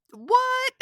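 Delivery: high squealing laugh around what; incredulous laughing. Real, not acted. Reacting to Viper with shocked high laughter. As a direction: laugh in disbelief, voice jumping up.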